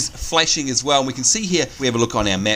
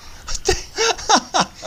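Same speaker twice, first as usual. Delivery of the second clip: sudden high laugh; burst of laughter; 14 semitones higher than usual. Real, not acted. Laughing at hovering character.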